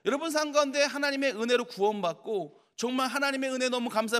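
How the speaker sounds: usual speaking voice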